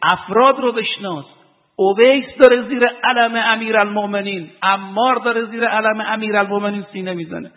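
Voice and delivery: rising sermon fervor; fervent preaching. Acted. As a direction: preach it hard to the crowd. Preacher rises to fervent appeal.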